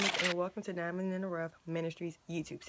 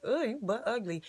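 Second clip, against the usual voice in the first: light joking jab, a little louder; playful tease; 4 semitones higher, 5 dB louder than usual. Shown as a demonstration. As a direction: toss it off as a joke, teasing. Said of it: "you're not even gonna think about what I said".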